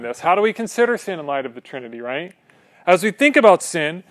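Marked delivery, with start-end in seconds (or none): 2.9-4.0 emphatic. Real, not acted. Preacher emphasis.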